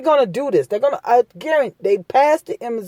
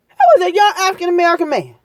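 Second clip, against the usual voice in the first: indignant; 7 semitones higher, much faster than usual. Real, not acted. Outraged retelling, rushed.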